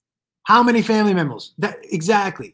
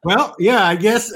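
usual speaking voice

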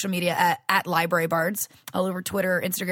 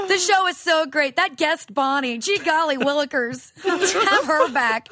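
goofy self-praise; 7 semitones higher than usual. Pretend.

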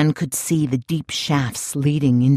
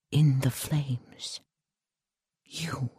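slow unvoiced whisper, softer; whispered menace; 8 dB quieter, much slower than usual. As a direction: breathe the words out, slow and cold. Menacing reveal to the Englishman.

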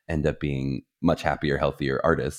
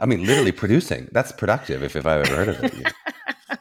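laughing; 3 semitones higher, 4 dB louder than usual. Real, not acted. Laughing at baby joke.

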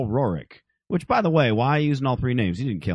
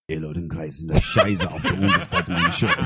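low, gentle German menace, laughing through it; soft German; 10 semitones lower than usual. Pretend. A soft German line whispered to a friend on the show, low and laughing. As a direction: purr the German line softly like a villain. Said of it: "I'll do the soft German".

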